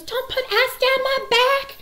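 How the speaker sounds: very high, loud, slow movie-quote holler; mock shriek. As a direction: squeal it like the startled mama. Mama shrieks at ice prank.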